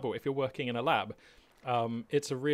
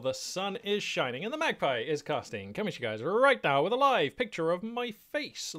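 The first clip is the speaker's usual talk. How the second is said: hyped channel intro; 7 semitones higher, 4 dB louder than usual. Acted. Streamer greeting viewers to the channel, hyped showman intro. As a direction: welcome the viewers with big announcer flourish.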